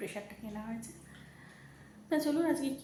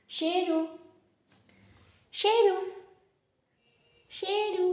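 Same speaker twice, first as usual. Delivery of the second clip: calling out; 7 semitones higher, 5 dB louder, much slower than usual. Real, not acted. Calling the dog by name.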